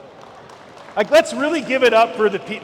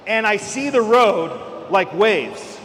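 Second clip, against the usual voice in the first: ordinary delivery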